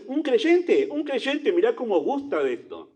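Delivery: amazed onlooker. Retold or imitated. A church member amazed, praising a newcomer's zeal. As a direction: gush with wonder over the newcomer. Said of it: "Y uno dice".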